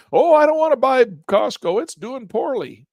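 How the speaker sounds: high, loud whiny buyer refusing the dip; mock nervous investor. Teacher mimics timid buyers who shun Costco, whiny. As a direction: squeal it like a spooked investor.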